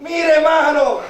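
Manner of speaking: fervent, insistent preaching; prédica a viva voz. Real, not acted. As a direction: preach it out, voicing the mother's resolve. (predicación encendida)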